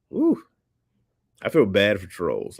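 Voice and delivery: ordinary talk, nothing marked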